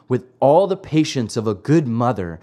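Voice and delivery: usual speaking voice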